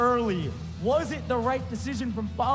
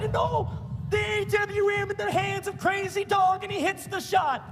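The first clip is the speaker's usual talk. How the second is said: excited shout; 3 dB louder than usual. Real, not acted. Shock kill call.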